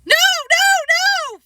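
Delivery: put-on very high loud child shout. Boy dodges a spanking, loud high shout. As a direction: yell no in panic. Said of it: "Fudge shouted".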